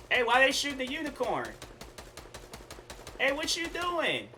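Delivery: high-pitched protest; indignant calling out. Real, not acted. Gamer seeing them shooting the unicorn, indignant. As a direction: call out high in protest, stretching the words.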